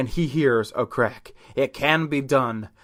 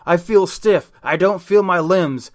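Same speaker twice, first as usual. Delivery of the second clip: mild panic; 5 semitones higher, 4 dB louder than usual. Acted. Buddy panics at his new body.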